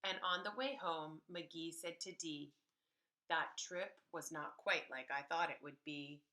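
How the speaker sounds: usual speaking voice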